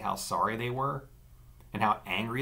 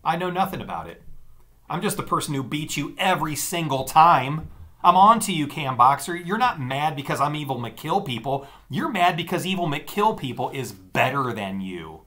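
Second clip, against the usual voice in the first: taunting; 6 semitones higher, 7 dB louder than usual. A narrator's reading. Sister mocking taunt.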